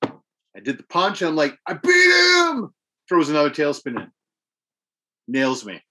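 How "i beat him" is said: very high, loud, slow victory cry; drawn-out triumphant yell; triumphant shout. Retold or imitated. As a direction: scream it like you finally won. Retelling the moment of victory.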